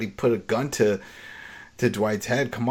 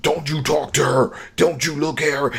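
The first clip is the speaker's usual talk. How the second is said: heated warning; 4 semitones higher than usual. Retold or imitated. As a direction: mildly act out the threat of the protective husband. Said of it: "He gets all up in it".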